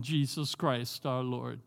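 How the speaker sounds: normal talking voice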